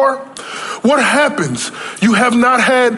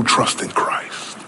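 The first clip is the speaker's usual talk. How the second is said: slow, soft unvoiced whisper; 10 semitones lower, 8 dB quieter, slower than usual. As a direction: drop to a hush on trust in Christ. End of the promise whispered.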